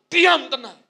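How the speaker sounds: loud firm command; commanding rebuke. Shown as a demonstration. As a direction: demonstrate speaking faith aloud. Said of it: "berani mengucapkan firman".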